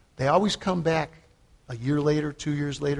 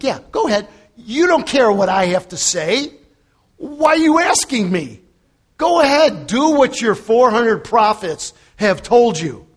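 louder, higher, exasperated sarcasm; sarcastic; 6 semitones higher, 5 dB louder than usual. Acted. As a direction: throw it back at them in mock permission. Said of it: "with a little sarcasm".

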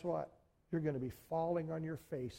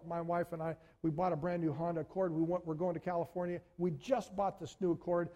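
normal talking voice

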